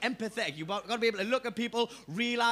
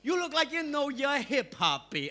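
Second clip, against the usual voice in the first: mixtape salesman voice; 5 semitones higher, slower than usual. Retold or imitated. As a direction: play the friendly Harlem hustler. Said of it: "He goes".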